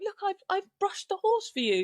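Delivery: high, pleased imitation; imitated proud partner. Partner showing off the brushed horse, imitated pride.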